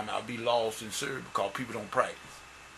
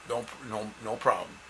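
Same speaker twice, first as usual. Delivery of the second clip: ordinary talk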